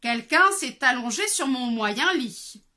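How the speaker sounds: measured middle bear. Acted. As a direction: middle bear, slow and firm. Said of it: "dit alors de sa moyenne voix".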